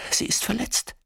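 ganz tonlos gehaucht, verletzt leise; Flüstern. A narrator's reading. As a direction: hauch es ohne Stimme. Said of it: "flüstert sie". (tonloses Flüstern)

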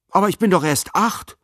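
put-on boy's high-pitched protest; indignant child protesting. A narrator's reading. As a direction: object indignantly, voice rising high. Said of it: "protestierte Noah".

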